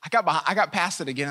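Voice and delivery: normal talking voice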